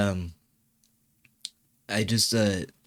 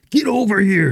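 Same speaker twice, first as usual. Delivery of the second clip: high, loud battle-cry catchphrase; hammed-up arcade fighter call, pitch swooping down at the end; game catchphrase shout; 11 semitones higher, 5 dB louder than usual. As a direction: yell it like a fighting-game taunt. Mortal Kombat catchphrase shouted out.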